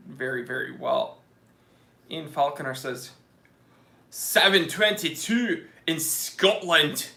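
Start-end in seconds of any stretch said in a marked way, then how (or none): 4.1-7.1 slow, mock Scottish accent, a bit louder; hammed-up Scottish accent reading a chat time. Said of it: "I just do accents of wherever you're from".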